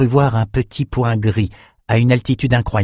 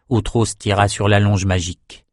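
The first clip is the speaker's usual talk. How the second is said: ordinary delivery, nothing marked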